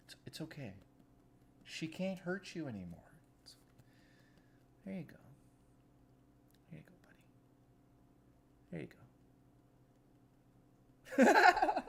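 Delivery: hushed, soft reassurance to a small frog; gently calm a scared little pet; soothing a pet. Real, not acted. Talking to the frightened frog, soft and soothing.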